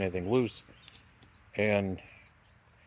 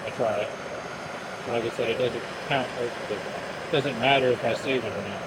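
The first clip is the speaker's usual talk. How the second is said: raised voice over noise; 2 semitones higher, 5 dB louder than usual. Real, not acted. Talking over running torch.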